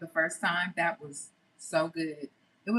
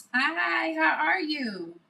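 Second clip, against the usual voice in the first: much higher, slow cooing hello; high sweet greeting; 8 semitones higher, 4 dB louder, much slower than usual. Acted. Greeting viewers in put-on voice.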